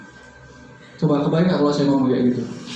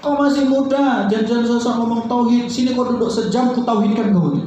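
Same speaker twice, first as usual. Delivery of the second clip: a higher, lightly voiced haughty elder preacher; condescending ustadz; 8 semitones higher than usual. Preacher imitating an ustadz who belittled him, haughty. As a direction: talk down to the young one like a proud ustadz. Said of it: "ada seorang ustadz pernah gituin saya".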